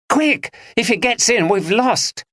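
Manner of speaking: high, panicked urgent cry; panicked high warning to the group; frantic cry. Acted. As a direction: cry out in alarm that he's coming. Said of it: "cried Andrea".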